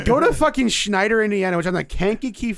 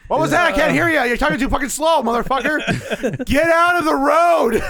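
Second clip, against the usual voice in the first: higher mock-irate holler; impatient heckling; 5 semitones higher than usual. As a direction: holler over at a slowpoke, hammed up. Acting impatience at slow talker.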